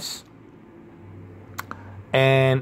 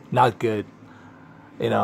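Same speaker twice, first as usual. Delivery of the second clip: ordinary talk, nothing marked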